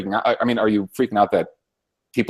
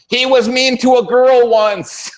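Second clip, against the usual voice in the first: high, slow, mock-whiny complaint; mocking whiny liberal; 11 semitones higher, 6 dB louder, slower than usual. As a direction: pitch it up and drag it out to mock them. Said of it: "and they're like".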